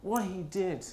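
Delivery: slow, gentle, knowing question; voice of God. Retold or imitated. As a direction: let it land slowly, like a quiet revelation. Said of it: "God spoke to me about it afterwards and went".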